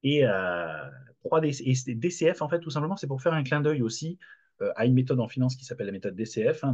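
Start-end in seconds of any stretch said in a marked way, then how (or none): none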